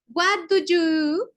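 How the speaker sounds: slow, clear English model; slow teacher's model of "What do you do?"; English phrase modelled. Shown as a demonstration. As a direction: spell out "What do you do?" word by word, slowly. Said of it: "Continuemos compartiendo".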